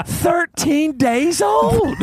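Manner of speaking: very high, drawn-out mock-amazed cry; imitated elderly woman's astonished exclamation; retold marvelling exclamation. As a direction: stretch it out high in hammed-up wonder. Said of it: "And she goes".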